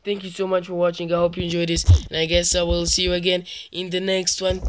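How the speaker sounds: loud, high, rushed panicky sign-off; cowardly panic. Acted. Player bails out of a horror game to viewers, cowardly panic. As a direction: gabble the outro like a coward desperate to flee. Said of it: "That's how cowards would say it".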